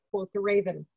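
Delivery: ordinary delivery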